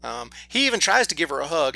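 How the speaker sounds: ordinary talk, nothing marked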